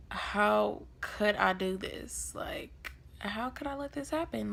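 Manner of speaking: hushed, disbelieving murmur to oneself; soft self-questioning. Retold or imitated. Teen sitting alone in jail cell, hushed self-questioning. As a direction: ask yourself quietly, in mock despair. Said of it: "So I'm contemplating life".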